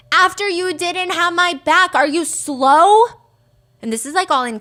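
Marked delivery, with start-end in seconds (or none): 0.1-3.1 higher, slow, louder, every word leaned on hard; all-caps emphasis. Retold or imitated. Mackenzie's all-caps text to Dom, read slow and loud. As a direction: read the caps text like it's shouted, slowly. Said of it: "That's why I'm emphasizing certain words".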